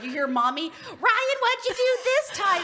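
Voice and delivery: high-pitched mommy mimicry; squeaky, drawn-out mock scolding; mocking wife impression. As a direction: mimic a mad wife to the dogs. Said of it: "imitates me to the dogs".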